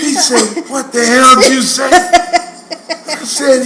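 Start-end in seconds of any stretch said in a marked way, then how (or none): 0.7-1.9 imitated dad's loud, high outburst; angry father. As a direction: demand an answer as an outraged dad. Angry father on phone, retold.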